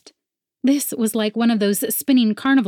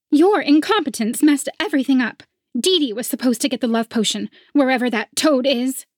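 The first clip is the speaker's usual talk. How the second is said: scornful rival; 6 semitones higher, 3 dB louder than usual. A narrator's reading. Rival blames.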